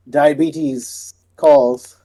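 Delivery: very slow, words stretched; a slow mock-dramatic sigh of a line; drawn-out punchline. Real, not acted. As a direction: drag it out for the joke. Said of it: "dramatic punchline".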